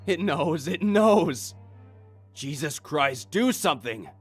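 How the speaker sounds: high, loud terrified cries; terror. Acted. Sobbing man begging the guard, in terror. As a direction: plead in terror. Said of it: "said with terror".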